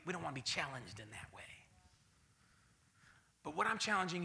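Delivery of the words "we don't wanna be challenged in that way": fast, hushed confession; whispered aside. Acted. Preacher whispering what the church really thinks. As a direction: let it slip under the breath.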